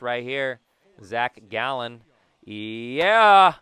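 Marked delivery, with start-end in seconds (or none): 2.4-3.5 long, loud, rising yeah; excited cheer. Real, not acted. Player cheers the hit, excited shout. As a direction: shout it higher and much louder.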